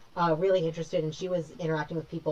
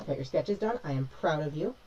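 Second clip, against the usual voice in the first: ordinary talk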